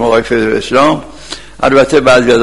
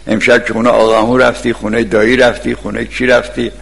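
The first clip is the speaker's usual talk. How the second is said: normal talking voice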